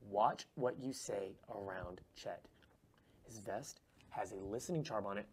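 low, slow, secretive warning; hushed warning. Acted. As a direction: lean in and warn them softly under your breath. Secret warning about listening charm.

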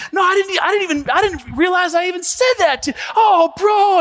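mock panic of a guilty friend; retold cry of dismay; distressed friend. Friend retold, upset and surprised.

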